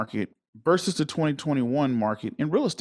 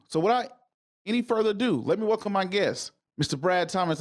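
high, hyped guest introduction; announcer; 5 semitones higher than usual. Acted. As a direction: build it up like a big-stage announcer. Host hypes up the guest's entrance.

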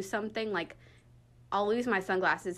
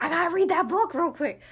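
higher, louder self-quote in a rush of resolve; mock urgency; 8 semitones higher, 6 dB louder than usual. Librarian retelling her reaction to a colleague, mock urgency. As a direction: blurt it as a hurried realization.

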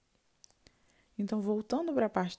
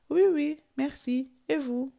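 cheerful reply; 6 semitones higher, slower than usual. Shown as a demonstration. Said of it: "ela vai te responder".

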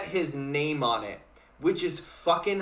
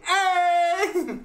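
excited shout; 12 semitones higher, 3 dB louder than usual. Real, not acted. Joking demand for his money.

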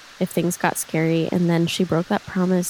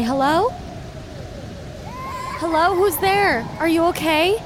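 high, raised call into the storm; calling out as Thea; 10 semitones higher than usual. Acted. Thea calling into the storm for the crying voice. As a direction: call out to an unseen voice. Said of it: "She's gonna stand up and say".

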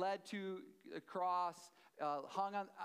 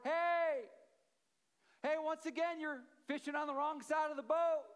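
higher, louder shout across the water; yelling from the shore; 9 semitones higher, 6 dB louder than usual. Retold or imitated. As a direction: call out to the boat from far away. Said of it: "somebody yells out from the shore".

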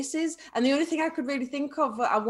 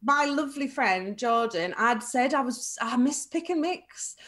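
ordinary delivery

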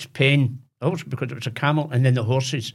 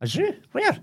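high, disbelieving question; a wee boy who can't believe it; surprised. Retold or imitated. Boy asks his dad where a zoo could be, surprised. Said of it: "I'm going".

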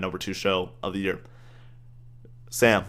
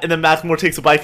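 usual speaking voice

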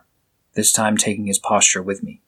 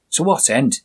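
put-on Scottish accent; 6 semitones higher than usual. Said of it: "with a strong Scottish accent".

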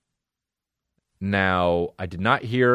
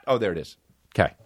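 normal talking voice

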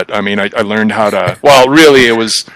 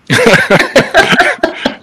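high, breathy burst of laughter. Real, not acted. Laughing at Spencer's insult, high breathy burst of laughter. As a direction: crack up at the insult.

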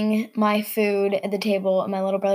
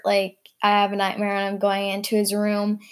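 ordinary talk, nothing marked

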